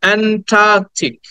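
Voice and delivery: "Antarctic" slowly, stretching the middle "taa"; "Antarctic" as "aen-TAAAK-tik", slow; drawn-out long "aa". Shown as a demonstration. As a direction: hold the long vowel in "Antarctic". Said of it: "একটু টেনে বলতে হয়".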